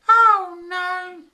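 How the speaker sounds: high-pitched loud wife's cry of dismay. A narrator's reading. Angry wife catching her husband working late, loud high cry. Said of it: "she cried".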